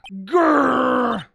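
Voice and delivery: rolled, voiced-out tiger growl.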